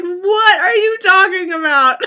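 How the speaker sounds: baffled mom laughing. Retold or imitated. Mom confused by her daughter, laughing. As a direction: puzzle over it while cracking up. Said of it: "She was like".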